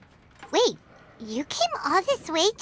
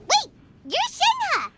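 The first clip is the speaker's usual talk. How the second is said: sudden high yelp of recognition; surprised; 8 semitones higher than usual. Acted. Paimon spotting a friend in the tavern, surprised and higher. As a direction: spot an old friend out of nowhere. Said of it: "This is a surprise".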